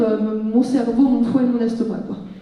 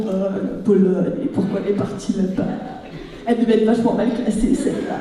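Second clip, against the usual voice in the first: sceptical onlookers; 3 semitones lower than usual. Retold or imitated. Imagined audience judging her exchange in Poland, mock-sceptical. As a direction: imitate doubters muttering their judgement. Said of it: "j'en vois plein qui font".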